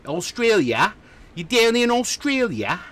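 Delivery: high, drawn-up Australian accent, playfully put on; mock Australian accent. Host asking a chatter where he is, mock Australian accent. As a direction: put on an exaggerated Aussie accent.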